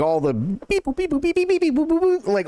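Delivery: high-pitched beep boop noises; robot beeps. Retold or imitated. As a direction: chirp the computer sounds way up high. Imitating computer beeps in training.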